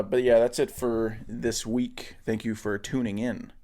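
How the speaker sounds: usual speaking voice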